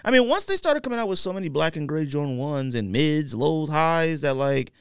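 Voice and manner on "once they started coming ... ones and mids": ordinary delivery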